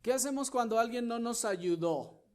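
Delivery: high, loud, urgent question; loud preaching cry. Acted. Pastor confronting the brethren, high loud cry. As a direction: shout the challenge.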